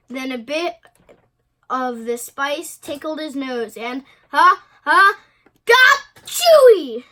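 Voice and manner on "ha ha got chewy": huge high, loud sneeze after gasps; acted sneeze. A narrator's reading. Spice tickles kitty boy's nose, big acted sneeze. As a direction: build up and explode into a drawn-out sneeze. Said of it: "the kitty boy sneezed".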